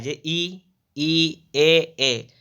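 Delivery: ई and ए each said twice, clearly and separately; front vowels recited. Shown as a demonstration. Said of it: "अग्र स्वर". (अग्र स्वरों का स्पष्ट उच्चारण)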